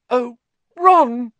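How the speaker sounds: high, loud emotional outburst; tearful cry. A narrator's reading. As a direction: cry out his name, breaking down. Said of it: "broke down completely".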